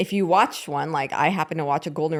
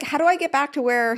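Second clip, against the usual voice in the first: lightly imitated mom, higher pitched; a toned-down impression of your mother; mom's real voice; 9 semitones higher than usual. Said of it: "that's more what she sounds like".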